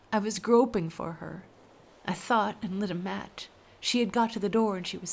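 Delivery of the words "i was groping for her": plain reading voice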